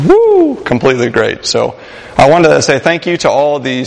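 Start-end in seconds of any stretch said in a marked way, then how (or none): 0.1-0.6 high excited woo; excited whoop. Real, not acted. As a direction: let out a happy whoop. Praising the youth event.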